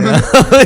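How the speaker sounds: high, loud cackle; loud burst of laughter. Real, not acted. Friend laughing at the zombie whiskey idea. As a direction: break up laughing.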